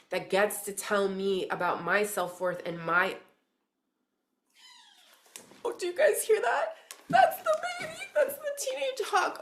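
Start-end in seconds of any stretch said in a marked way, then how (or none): 5.6-9.4 very high, loud thrilled squeal; excited squeal. Real, not acted. Reader tells viewers she hears the teenage hawk, excited squeal. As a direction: burst out in real high-pitched excitement.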